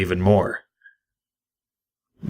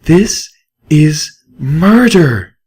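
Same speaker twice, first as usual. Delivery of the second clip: high, slow mare, outraged; Velvet Remedy; 7 semitones higher, 4 dB louder, much slower than usual. A narrator's reading. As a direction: spell out the accusation slowly. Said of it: "a stare that could petrify".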